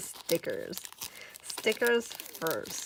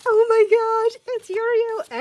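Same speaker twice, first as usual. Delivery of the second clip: very high, loud fangirl shriek; ecstatic squeal; 13 semitones higher, 9 dB louder, slower than usual. Real, not acted. Fan unwrapping Yurio artwork, ecstatic squeal. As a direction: shriek it with delight. Unwrapping art of favourite character.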